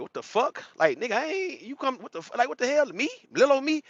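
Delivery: stunned disbelief. Retold or imitated. Streamer mimicking rival's shocked reaction, pitched high. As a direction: play the guy floored he got called out.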